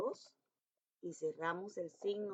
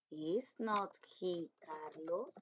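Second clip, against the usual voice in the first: very slow, each word set apart; slow dictation; 5 dB quieter, much slower than usual. Shown as a demonstration. Teacher dictating the negative question slowly to pupils. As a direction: read it out for the children to copy. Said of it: "teacher reads negative question".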